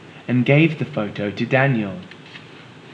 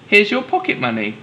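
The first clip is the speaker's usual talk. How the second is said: high, motherly storybook mum; 10 semitones higher, 3 dB louder, faster than usual. Acted. Mum telling Daniel to pay himself, high storybook voice. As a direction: lift into a bright high mum voice.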